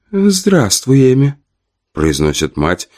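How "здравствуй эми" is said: high sweet mother's greeting. A narrator's reading. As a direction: greet her sweetly, a bit tipsy. Tipsy mother greets daughter.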